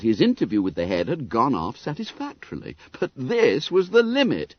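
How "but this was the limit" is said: usual speaking voice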